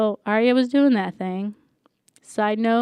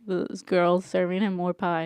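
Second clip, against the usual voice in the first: ordinary talk, nothing marked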